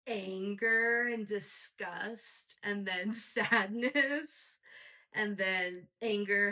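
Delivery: slow and loud, each word weighted; drawn-out emotion list. Real, not acted. Host listing the episode's emotions, slow and loud. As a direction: lean hard on every feeling, slowly.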